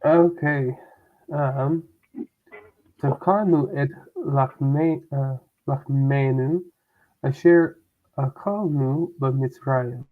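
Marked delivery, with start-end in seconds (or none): 3.0-10.0 slow reading. Shown as a demonstration. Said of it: "student reading Hebrew verse slowly".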